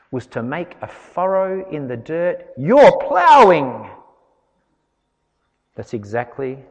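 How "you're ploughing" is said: mock outraged verdict. Mocking the legalists' verdict on spitting.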